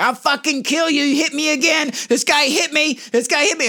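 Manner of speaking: high, threatening madwoman; crazy lady threatening. Retold or imitated. Man imitating a woman threatening him with keys, high voice. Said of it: "she's like".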